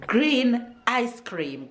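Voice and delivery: very slow, stretched out; mother modelling a colour word, very slowly; drawn-out teaching. Real, not acted. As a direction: stretch every word for the little one. Mom teaching colours to toddler.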